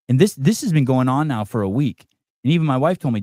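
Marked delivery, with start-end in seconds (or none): none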